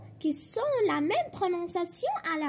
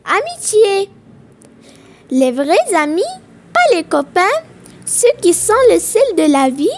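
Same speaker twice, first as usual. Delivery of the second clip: loud, measured verse reading with pauses between lines; poem recitation; 15 dB louder than usual. Acted. Teacher reads a friendship poem to pupils, projected recitation. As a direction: recite it line by line, projecting clearly.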